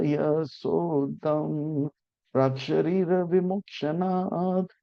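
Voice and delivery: slow intoned Sanskrit shloka; verse recitation. Real, not acted. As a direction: intone the verse slowly, rising toward the middle. (धीमा, गाया-सा श्लोक पाठ)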